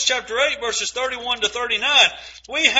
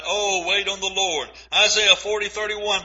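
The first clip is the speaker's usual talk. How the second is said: usual speaking voice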